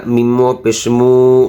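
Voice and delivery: ordinary delivery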